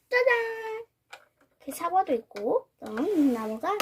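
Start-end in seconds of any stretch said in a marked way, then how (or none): 0.1-0.8 high, bright reveal; excited ta-da. Real, not acted. Crafter showing the finished tree to viewers, excited. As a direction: present it with delight.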